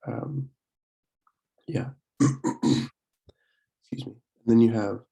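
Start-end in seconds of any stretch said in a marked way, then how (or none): none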